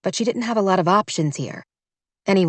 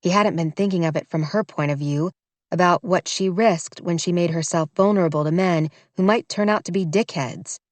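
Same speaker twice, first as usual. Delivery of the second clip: ordinary delivery, nothing marked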